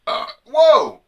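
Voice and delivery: loud, high startled cry; startled whoa. Real, not acted. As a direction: blurt it out, caught off guard. Startled outburst at own fart.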